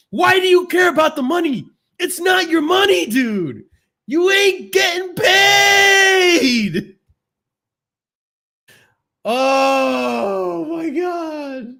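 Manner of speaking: furious rant. Real, not acted. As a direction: scream at the caller in real fury. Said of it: "I'm getting triggered".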